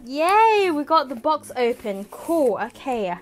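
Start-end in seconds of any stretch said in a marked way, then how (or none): 0.1-2.1 high, loud yay; bright burst of celebration; happy cheer. Real, not acted. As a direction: cheer it out in triumph. Box finally cut open.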